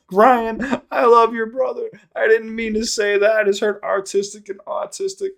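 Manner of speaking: high, loud, apologizing through laughter; can't stop laughing while saying sorry; laughing apology. Retold or imitated. Dad apologizing to Ryan, laughing high and loud. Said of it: "he started busting out laughing".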